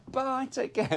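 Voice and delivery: high breezy farewell; mock cheery goodbye. Bond bidding the villain a high, mock cheery farewell. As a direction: wave him off sweetly.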